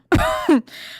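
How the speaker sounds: sudden high laugh; high-pitched laugh. Real, not acted. Telling where to put the stuff, high-pitched laugh. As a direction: burst into a squeaky laugh.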